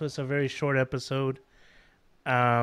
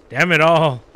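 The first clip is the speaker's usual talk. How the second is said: frustrated outburst; 5 semitones higher, 13 dB louder than usual. Real, not acted. Frustrated gamer after boss ending.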